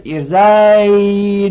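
ارزی held on a long 'ay', then a doubled n; unvoweled ya clash. Shown as a demonstration. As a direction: stretch ارزی loud and high into er-zaaay-nna.

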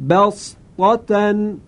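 بسطة in drawn-out Quran recitation; Quranic recitation. Shown as a demonstration. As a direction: recite بسطة melodically and slowly. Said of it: "این کلمه است".

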